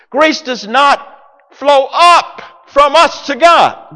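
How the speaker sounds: high, slow shouted sermon line; slow and loud, preaching it hard. Acted. Preacher shouts to the congregation that grace does not flow up.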